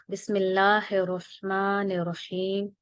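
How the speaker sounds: slow, measured recitation of the Bismillah; Quranic recitation. Acted. Teacher opening the lesson for students, slow recitation. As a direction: intone it slowly like opening a Quran reading.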